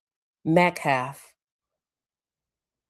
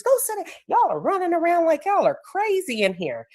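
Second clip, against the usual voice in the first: high-pitched scolding, acted not shouted; mock yelling; 13 semitones higher than usual. Retold coach scolding kids running wild, mock yelling. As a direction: imitate an exasperated coach ordering kids to sit. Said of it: "He's yelling at the kids".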